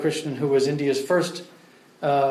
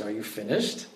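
softer, patient, unhurried question; 6 semitones lower, 9 dB quieter than usual. Retold or imitated. Prabhupada patiently asks the arguing student, quiet and calm. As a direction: wait him out gently before asking. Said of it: "face is getting redder and redder".

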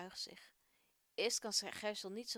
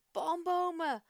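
high, loud glad cry; happy laugh-call; 7 semitones higher, 9 dB louder, slower than usual. Acted. Caleb spots palm trees and calls out happily. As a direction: announce it with joyful delight. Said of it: "lacht hij blij".

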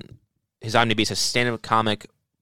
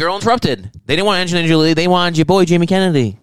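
loud, higher hammed-up brag; big raised voice, imitating a self-promoter; mock boasting; 6 semitones higher, 7 dB louder than usual. Riffing on a comedian's videos, loud mock bragging.

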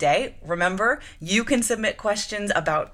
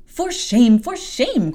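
high, loud scandalised cry; 7 semitones higher, 7 dB louder, slower than usual. Acted. Lady's maid to Jane, shocked scolding cry. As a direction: scold her in outrage. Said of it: "cried the lady's maid".

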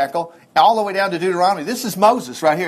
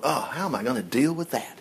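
low, soft, deflated sigh of words; discouraged; 6 semitones lower, 8 dB quieter than usual. Acted. Preacher acts out his reaction to bad news, discouraged. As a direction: mutter it to yourself, heavy-hearted. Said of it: "I get discouraged pretty quick".

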